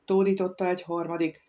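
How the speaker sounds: ordinary delivery, nothing marked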